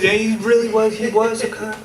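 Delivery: imitating people who think it's a revelation; earnest mock reverence.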